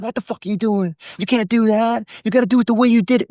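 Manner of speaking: high-pitched mock outburst; Harvey Keitel losing it. Actor angry at co-star for changing the scene, mock outburst. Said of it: "he loses his shit".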